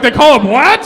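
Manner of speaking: high, loud disbelief; shocked guy asking back; incredulous. Retold or imitated. Date's friend hearing the term, incredulous and loud. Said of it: "he's like".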